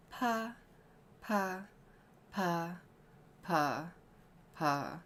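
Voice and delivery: pa rising step by step very high, then sliding back down; soft voice-training pitch glide; pitch siren on pa. Shown as a demonstration. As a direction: sweep up through the range on each pa and come back down. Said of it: "whereas I'm going up, you go down".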